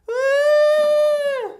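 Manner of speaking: loud, high held wail; mock uncontrollable crying. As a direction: burst into a hammed-up bawl. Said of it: "she'll start crying uncontrollably".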